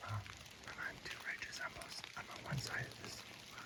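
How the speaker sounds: hushed whisper to camera; hunter's whisper. Real, not acted. Hunter on a ridge updating viewers from the stand, whispered.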